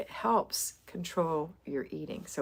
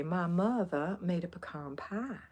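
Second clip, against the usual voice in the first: put-on soft Georgia accent. Quoting folks where I went to school, soft Georgia accent. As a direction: imitate a Georgia accent, pie as "pah". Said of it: "that's the way they talked".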